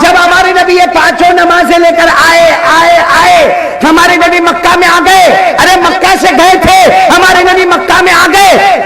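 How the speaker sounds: high, ringing proclamation; full-force preaching. Acted. Preacher declares the command of prayer to the faithful, high full-force preaching. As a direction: proclaim it to the whole congregation.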